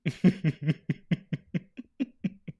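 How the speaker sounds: long helpless wheezing laugh; real laughter. Real, not acted. Reacting to Blocko's video, helpless laughter. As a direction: crack up, high and drawn out. Cracking up at the clip.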